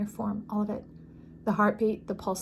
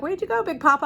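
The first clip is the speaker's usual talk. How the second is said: higher, louder puzzled call; mock-surprised call to a missing card; surprised search; 7 semitones higher, 4 dB louder, faster than usual. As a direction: call out as if looking for him. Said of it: "I'm like".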